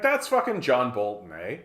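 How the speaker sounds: normal talking voice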